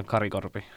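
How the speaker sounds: "Kari Korpi" in native Finnish, tapped r; name pronunciation demo. Shown as a demonstration. Student repeats his name for the host, native pronunciation. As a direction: model "Kari Korpi" for a learner, Finnish vowels. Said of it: "So your pronunciation again?".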